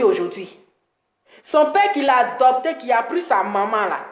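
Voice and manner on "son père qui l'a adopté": ordinary talk, nothing marked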